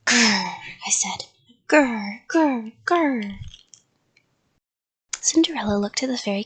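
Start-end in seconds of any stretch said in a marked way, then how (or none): 0.0-0.6 loud, acted "grr"; scary clown growl. A narrator's reading. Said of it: "I made a scary clown face". 1.7-3.4 loud, acted "grr"; scary clown growl. A narrator's reading. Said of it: "I made a scary clown face".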